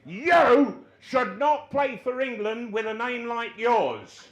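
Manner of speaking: very loud, high, slow scolding; hammed-up raging boss voice; furious manager. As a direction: bellow it word by word. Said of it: "He said".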